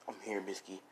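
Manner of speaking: hushed and low, retold comfort; gentle reassurance. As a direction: soothe her quietly. Comforting a frightened woman.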